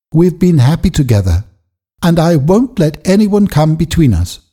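a daughter standing by her father; Eppie's firm young voice. A narrator's reading.

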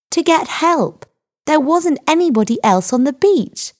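high-pitched, exasperated explanation; insistent explaining. A narrator's reading. Girl justifying herself to the sergeant, insistent.

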